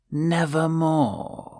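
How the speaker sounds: a touch of the raven's croak; raven's reply. A narrator's reading. The raven replying to the narrator, lightly croaked. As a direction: give the bird's word a little lift. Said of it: "Then the bird said".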